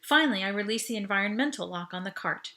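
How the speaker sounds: ordinary delivery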